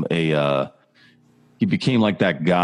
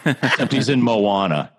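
normal talking voice

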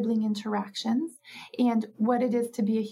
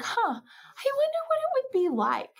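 put-on much higher, dreamy child's voice; child wondering. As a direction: wonder aloud like a curious kid. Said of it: "the child is like".

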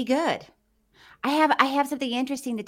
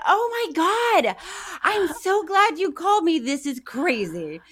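retold excitement; 8 semitones higher, 3 dB louder than usual. Retelling her delighted reply to Kathy's call, excited and higher. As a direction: relive the thrill of the surprise call.

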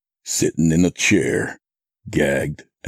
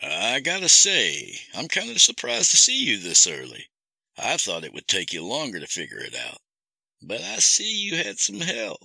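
high, soft-edged villain voice; taunting captor; 7 semitones higher, 3 dB quieter, faster than usual. Acted. Captor greets Sam through the webcam, taunting. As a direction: toy with them as the captor. Kidnapper's voice in narrated story.

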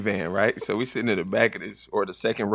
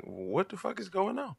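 shocked; 10 semitones higher, 11 dB quieter than usual. Retold or imitated. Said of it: "I'm like".

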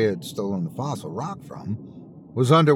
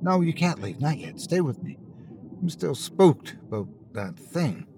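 begging; 4 semitones higher, 3 dB quieter than usual. Acted. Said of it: "Ray begged".

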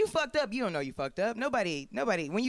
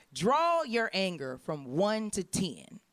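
slow questioner voice; much slower than usual. Retold or imitated. Intake test question read out.

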